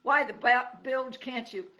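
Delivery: loud, sharp outburst; cranky porcupine snapping. Acted. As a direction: cut off a curse, annoyed. Porcupine snaps.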